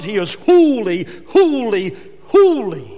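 each holy rising in force. Shown as a demonstration. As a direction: proclaim He is holy, holy, holy with mounting weight.

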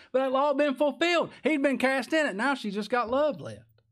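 high-pitched fervent outburst; preacher carried away with joy; jubilant preaching. Real, not acted. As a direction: exult in it, pitch way up.

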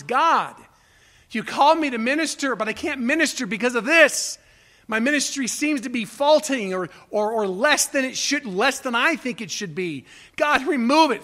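urgent cry of frustrated prayer; desperate plea. Retold or imitated. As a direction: plead for the thorn to go. Paul begs God three times.

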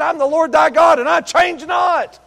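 high, loud, slow proclamation; booming voice of God. Acted. Preacher voicing the Lord to the church, booming. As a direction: thunder it out as God speaking. Voicing God's words, shouted.

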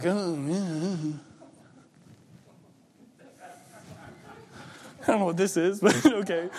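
imitating an awkward, laughing brush-off. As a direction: laugh it off uneasily. Server reacting to gospel tract.

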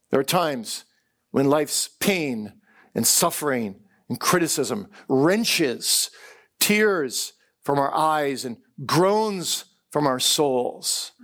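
ordinary talk

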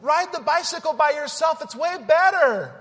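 high, loud, laughing devil's voice, almost a squeal; tempting devil. Acted. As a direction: egg them on like a gleeful devil. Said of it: "he's on the side saying".

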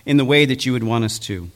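hushed closing prayer. Acted. Opening prayer before sermon.

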